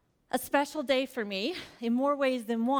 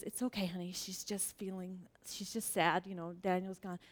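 soothing husband; 5 semitones lower, 10 dB quieter than usual. Retold or imitated. Husband calms daughter.

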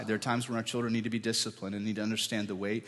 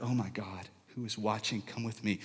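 softer, hissed parental embarrassment; embarrassed hush; 2 semitones lower, 9 dB quieter than usual. Retold or imitated. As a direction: hush the kid in public.

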